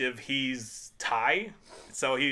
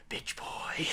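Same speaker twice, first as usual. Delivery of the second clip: whispered taunt; 9 dB quieter than usual. Retold or imitated. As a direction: hiss it into his ear. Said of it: "coming out of nowhere and saying".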